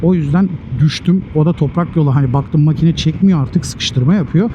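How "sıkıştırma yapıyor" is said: normal talking voice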